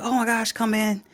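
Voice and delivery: mock-eager homeowner welcoming him in; light imitation of a thrilled lady; excited woman. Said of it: "She's like".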